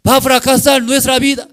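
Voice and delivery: shouted, high-pitched cries of doom; hammed-up despair at full voice; acted panicked suffering. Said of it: "Lo sufre, sufre".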